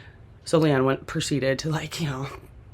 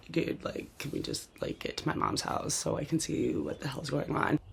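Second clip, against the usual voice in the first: hushed urging; 10 dB quieter than usual. Retold or imitated. Urging husband on the drive.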